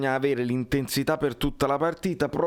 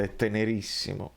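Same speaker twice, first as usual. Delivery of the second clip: ordinary talk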